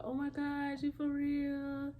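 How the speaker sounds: high, drawn-out excited gasp; cousin squealing in shock. Retold or imitated. Cousin Kia hearing the pregnancy news, high excited squeal. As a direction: squeal it in disbelief. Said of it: "And she was like".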